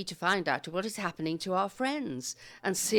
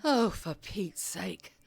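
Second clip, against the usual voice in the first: whispered under the breath in exasperation; exasperated whisper to herself; slower than usual. Acted. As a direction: mutter it in a hushed, fed-up breath.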